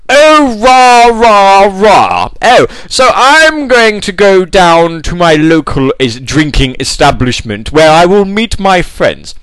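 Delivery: braying, drawn-out posh impression; mock elderly banker's upper-class drawl; old-fashioned toff. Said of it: "He's got that sort of accent".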